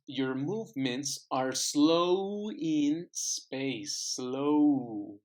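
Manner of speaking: teacher reads the example sentence to her students, slow and clear; slow teacher demo. Shown as a demonstration. Linking "slow in" spelled out at half speed. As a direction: read slowly and clearly so the linking shows. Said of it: "Slow in, slow in".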